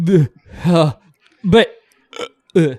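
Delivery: short, broken retches; gagging. Acted. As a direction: fight back sympathetic nausea. Gagging at the thought of vomit.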